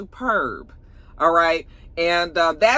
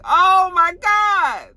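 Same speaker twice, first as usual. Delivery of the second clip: high, slow, drawn-out shout; a viewer recalls her reaction to the ending; shocked shout; 8 dB louder, much slower than usual. Retold or imitated. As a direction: wail it out in shock. Reliving the shocking scene.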